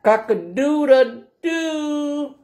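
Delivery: very high, long held crow; rooster crowing. Acted. Reader voices the rooster for children, crowing. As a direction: crow it out, stretching the final doo. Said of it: "crowed the rooster".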